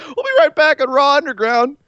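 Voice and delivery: hammed-up throw to commercials; Shane McMahon. Host mimicking the break during a fight club, mock announcer.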